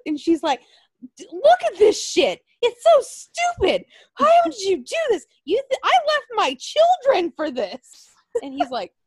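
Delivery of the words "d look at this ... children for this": very high, loud, slowed shrill rant; hammed-up indignant woman voice; mock outraged woman. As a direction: rant in a squeaky high put-on voice like a scandalized wife. [name] acted outrage, high voice.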